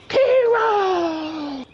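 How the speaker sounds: high, drawn-out name call; fight announcer. Retold or imitated. Announcer naming the winner, high drawn-out shout. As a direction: holler the winner's name to the crowd. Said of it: "then the guy goes".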